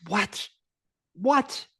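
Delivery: a retold double take of shock; stunned what. Shocked reaction to bride news.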